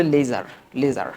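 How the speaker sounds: "লেজার" as LAY-zar, z and final r; Bengali-style "লেজার" with clear z; common pronunciation. Shown as a demonstration. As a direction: say "লেজার" the everyday z way. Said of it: "আমরা বলি হলো".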